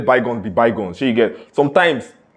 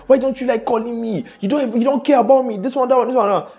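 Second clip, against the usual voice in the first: higher, whiny mock girlfriend voice; complaining girlfriend; 10 semitones higher than usual. Girlfriend complaining to her busy boyfriend, high mock whine. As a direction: nag like a neglected girlfriend, pitched up. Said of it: "she's like".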